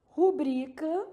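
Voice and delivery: Rubrica slowly, stressed on BRI; marking the stressed syllable. Shown as a demonstration. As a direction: draw out ru-BRI-ca. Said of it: "marcando as sílabas tônicas aqui".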